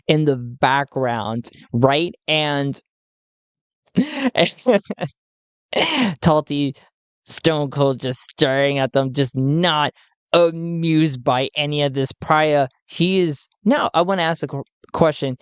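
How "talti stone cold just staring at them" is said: normal talking voice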